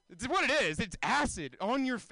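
ordinary delivery

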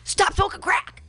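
mock high-pitched yell; harsh yelled order. Showing what not to say to drug users, mock high yell. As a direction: blurt it out as a shrill-pitched command. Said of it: "so you shouldn't say".

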